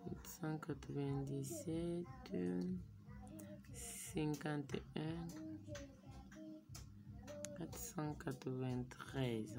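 softly whispering digits to himself, slow; murmured under breath. Real, not acted. Typing his account number, reading digits to himself in a slow whisper. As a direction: mutter the numbers slowly as you type them.

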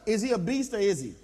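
ordinary talk

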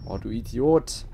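normal talking voice

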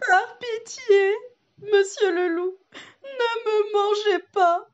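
high, slow tearful begging; pleading. Acted. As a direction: cry out for mercy. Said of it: "supplie le petit chaperon rouge".

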